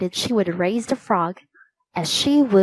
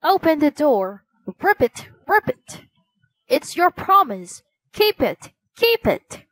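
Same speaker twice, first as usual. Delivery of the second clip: croaking frog voice; 6 semitones higher than usual. A narrator's reading. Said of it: "heard croaking at the door".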